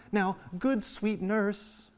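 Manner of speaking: high, drawn-out sweet wheedling; sweet-talking shift. Acted. Juliet buttering up the nurse for news, sweet-talking. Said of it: "shift in tone".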